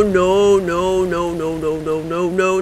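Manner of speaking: rapid string of refusals, fading and dropping in pitch; panicked muttering. Real, not acted. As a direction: back away from the scare, winding down. Backing away from the scare.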